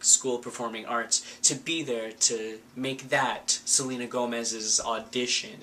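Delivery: usual speaking voice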